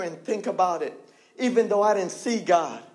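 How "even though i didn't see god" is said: ordinary talk, nothing marked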